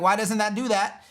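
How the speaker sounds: mock upset. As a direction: whine in protest as the frustrated user. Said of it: "they start to get angry and upset".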